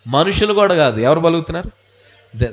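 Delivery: ordinary talk, nothing marked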